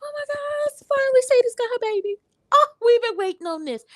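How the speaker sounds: very high, squealing with joy, nearly crying; overjoyed squeal. Retold or imitated. Viewer reliving the reunion on screen, overjoyed squeal. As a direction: shriek it like your favorite ending finally came.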